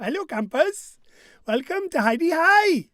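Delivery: loud, rising camp announcer call in a put-on accent; holiday-camp greeter. Quiz host greeting players like campers, weird-accented holiday-camp call.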